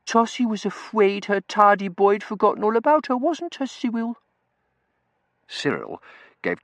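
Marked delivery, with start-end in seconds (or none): 0.1-4.2 put-on very high girlish pet voice; simpering, high-pitched cooing; baby talk. A narrator's reading. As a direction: whine coyly in baby talk. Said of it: "without a hint of baby talk".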